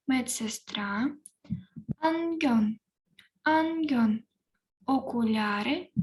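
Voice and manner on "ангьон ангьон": Ангьон higher, clear; Korean pronunciation. Shown as a demonstration. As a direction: model Ангьон. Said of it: "я прочитаю по декілька разів слово". («Ангьон» вище, чітко)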